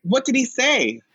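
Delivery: high, concerned voice; Noah's worried question. Retold or imitated. Noah asking LaChance what's wrong, high and concerned. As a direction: ask with worry. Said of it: "so Noah says".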